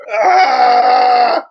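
loud, high melodramatic wail; hammed-up anguished shout; theatrical cry of despair.

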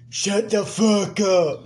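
slow, drawn-out mock tough guy; mock rapper line. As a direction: drag it out like a smug rapper. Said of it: "He's like".

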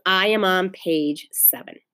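usual speaking voice

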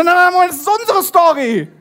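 high, loud mock outrage; indignant protest. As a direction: Emmaus disciples protest that the others stole their news, in mock outrage. Disciples protest the news was theirs.

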